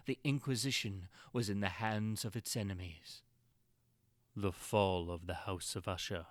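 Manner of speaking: plain reading voice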